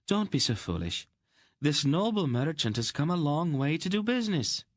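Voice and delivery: high, sharp scornful daughter voice; snapping daughter. A narrator's reading. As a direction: snap at him, high and scornful. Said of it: "Anhelde snapped".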